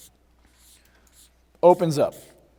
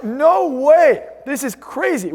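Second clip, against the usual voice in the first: higher, stunned "no way"; mock amazed disbelief; 6 semitones higher, much slower than usual. Retelling the crowd's amazement at the stage show, mock stunned. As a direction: play the dumbfounded onlooker, pitched up. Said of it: "And you're like".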